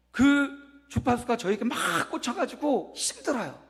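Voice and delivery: emphatic preaching. Real, not acted.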